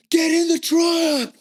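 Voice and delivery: exaggerated American yelling; American accent. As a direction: bark it like an over-the-top American official. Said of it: "This American accent".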